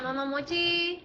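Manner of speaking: ordinary talk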